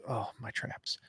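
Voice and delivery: whisper. Real, not acted. Aside about sore muscles.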